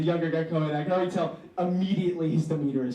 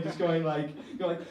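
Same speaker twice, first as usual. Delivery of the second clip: usual speaking voice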